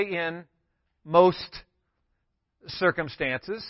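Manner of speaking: "most" set apart with pauses, "circumstances" drawn out slowly; a slow, weighed misquote with "most" singled out; stress on most. Shown as a demonstration. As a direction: linger on "most" then stretch "circumstances.". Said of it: "It doesn't say".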